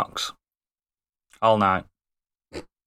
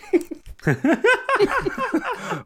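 squeaky high laugh; high giggle. Real, not acted. Laughs at own joke.

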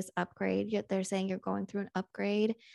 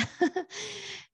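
high giggle; 13 semitones higher than usual. Real, not acted. Laughs mid-sentence, high.

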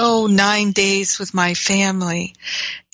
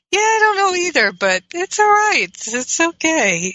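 soothing reassurance; 10 semitones higher than usual. Retold or imitated. Soothing reply to little nephew.

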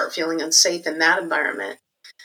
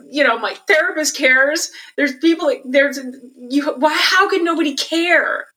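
higher, pleading indignation; retold outrage; 8 semitones higher than usual. Young self protesting to the designer, high indignant pleading. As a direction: protest like a hurt teenager, voice up high. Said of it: "I was pissed".

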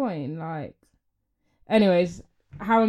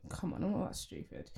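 whispered aside; 2 semitones lower, 15 dB quieter than usual. Real, not acted. Aside while defending herself.

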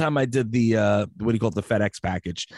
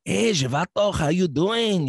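higher-pitched Spanish guy greeting; mock Spanish accent; 6 semitones higher, slower than usual. Shown as a demonstration. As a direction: put on a playful Spanish accent. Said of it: "you could do a Spanish guy".

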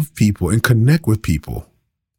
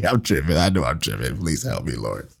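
trailing off softer into a mock prayer to the Lord; fading plea; 8 semitones lower, 8 dB quieter than usual. Real, not acted. Pastor laughing at himself, fading into a quiet mock prayer. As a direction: sink down to a soft groan of please help me. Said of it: "I'm tripping today".

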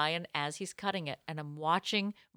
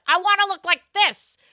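high, loud, eager request; hammed-up hopeful client; mock eager customer; 12 semitones higher, 10 dB louder than usual. Asking the hairdresser with a picture, in a high eager voice.

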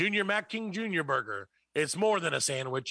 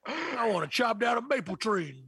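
macho man; 4 semitones higher than usual. Pretend. Mock macho bacon craver.

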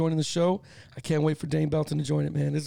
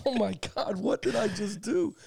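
shocked to himself; 4 semitones higher than usual. Retold or imitated. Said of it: "I said to myself".

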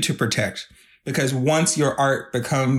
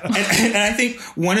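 laughing; 8 semitones higher than usual. Real, not acted. Laughing at Instagram reels joke.

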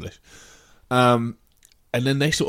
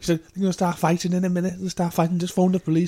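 pitched up, urgent pleading; pleading woman; 6 semitones higher than usual. Retold or imitated. Woman at a kebab shop counter, urgent pleading. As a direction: beg them to ring the police. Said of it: "she was like".

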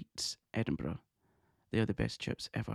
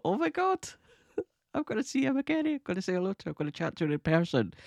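high, breathless-with-delight squeal of words; thrilled; 10 semitones higher, faster than usual. Retold or imitated. Fan reacting to an invitation to meet her idol, thrilled. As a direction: gush in a much higher voice.

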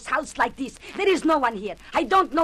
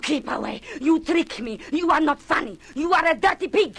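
furious scolding; 4 semitones higher than usual. Acted. Josephine rages at Bertie for tricking her, angry shout. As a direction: hurl the insults at him. She realises she was tricked.

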